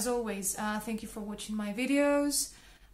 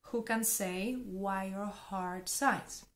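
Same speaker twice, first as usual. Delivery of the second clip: slow model reading; 3 dB quieter, slower than usual. Shown as a demonstration. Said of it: "but heart".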